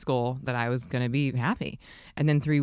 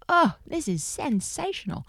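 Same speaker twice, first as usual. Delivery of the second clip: slow, high bad Australian accent; hammed-up Australian accent gushing; 12 semitones higher, slower than usual. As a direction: gush it in a mock Australian accent. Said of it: "she was Australian".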